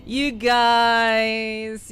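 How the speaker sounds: high, loud, stretched-out "you guys"; drawn-out tease. Pretend. Teasing chat about accents.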